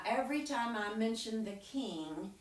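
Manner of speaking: plain reading voice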